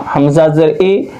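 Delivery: ordinary talk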